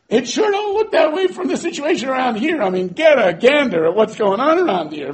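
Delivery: exasperated complaint. Acted. Gideon loudly complaining to the angel, exasperated. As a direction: complain loudly that nothing adds up.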